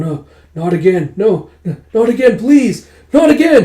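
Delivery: high, loud pleading that builds to a cry; nightmare panic. Acted. Man tossing in a nightmare begs, rising panicked cries. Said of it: "tossing and turning".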